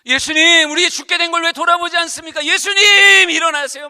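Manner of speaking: loud, high pleading cry to wake Jesus; desperate cry. Retold or imitated. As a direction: shout it like drowning men begging. Disciples crying out in storm. (겁에 질려 크게 외치는 제자들)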